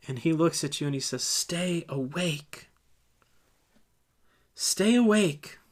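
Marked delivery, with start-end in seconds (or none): 1.2-2.7 soft, slow, drawn-out request; quiet plea. Acted. Leader quietly urging disciple, soft slow plea. 4.6-5.6 higher and emphatic, slow; firm urging. Acted. Leader repeating the command to disciple, firm urging.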